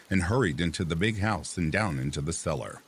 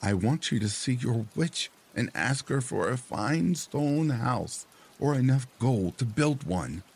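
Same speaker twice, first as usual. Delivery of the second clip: put-on high-pitched demanding old wife; 7 semitones higher than usual. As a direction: push for it like a greedy wife. Wife makes her demand.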